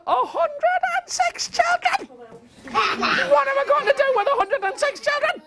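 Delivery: extremely high squealed wail; comic panic. Acted. Stan facing a hundred and six children, high panicked squeal. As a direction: shriek it out, utterly overwhelmed.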